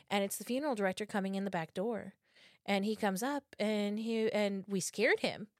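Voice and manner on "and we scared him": usual speaking voice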